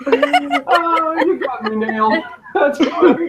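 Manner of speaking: high laughing reply. Real, not acted. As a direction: crack up at being imitated. Laughing at impression.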